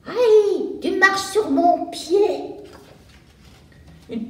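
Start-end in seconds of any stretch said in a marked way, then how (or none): 0.1-2.2 put-on high, loud yelp of a stepped-on girl; a little girl's loud ouch at a clumsy classmate; crying out in pain. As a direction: shriek it, someone just trod on your foot. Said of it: "quelqu'un qui crie".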